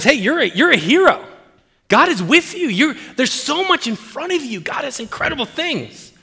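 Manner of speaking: high, eager angel proclamation; excited angel. Acted. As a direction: hail the hero high and excitedly. Angel hailing Gideon as hero.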